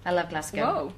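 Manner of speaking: low, soft Scottish lilt on Glasgow; Scottish accent. Shown as a demonstration. Singer showing off a little Scottish to her host, Scottish accent. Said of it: "that pronunciation down".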